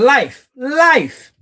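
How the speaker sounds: loud high call of the name. Real, not acted. Streamer shouting the name at chat, loud.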